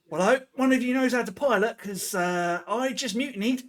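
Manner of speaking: high, loud, quick-starting character voice; mutineer in character. Acted. New bosun confessing mutiny to crew, high character voice. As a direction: pitch it up and rush into the admission.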